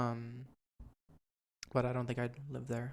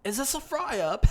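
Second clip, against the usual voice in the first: high, loud mock British accent; 10 semitones higher, 7 dB louder than usual. Shown as a demonstration. Showing off stock British phrases to a friend, hammed-up British. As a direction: squawk it in a put-on British voice. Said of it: "That's all I got".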